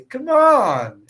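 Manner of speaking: mock-impatient outburst; exasperated protest. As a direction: draw out ON in loud disbelief. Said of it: "people will be just impossible".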